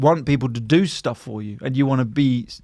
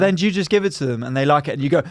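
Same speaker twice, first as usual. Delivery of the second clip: ordinary delivery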